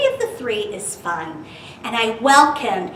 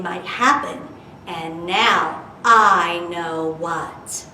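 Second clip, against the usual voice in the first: put-on lively read-aloud for kids; storybook reading; 4 dB louder, slower than usual. Teacher reading Dr. Seuss rhyme to children, lively.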